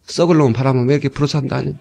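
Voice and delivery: low, deflated griping; spiritless grumble. Acted. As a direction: grumble it with no energy. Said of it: "그럼 되겠느냐".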